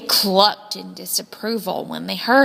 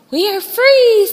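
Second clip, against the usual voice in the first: much higher and louder, a joyful shout; triumphant cry; 10 semitones higher, 10 dB louder than usual. A narrator's reading. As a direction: burst out in triumph. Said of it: "said Louise to the other chickens".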